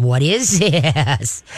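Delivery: slow, drawn out with a chuckle; amused, lingering wonder; laughing curious. Shown as a demonstration. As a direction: laugh with your voice as you ask. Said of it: "you gotta laugh with your voice".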